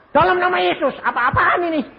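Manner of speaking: high, firm rebuke; rebuking in Jesus' name. Retold or imitated. Man rebuking the current in his hand, firm and high. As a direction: command it to stop in Jesus' name. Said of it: "tak tengking".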